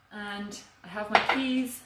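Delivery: ordinary talk, nothing marked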